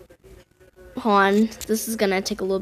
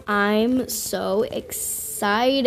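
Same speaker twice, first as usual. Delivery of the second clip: very slow, softer deadpan; mock calm; 2 semitones lower, 5 dB quieter, much slower than usual. Gamer pretending to stay calm, slow deadpan. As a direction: drag it out flat and quiet. Waiting for the pet to hatch.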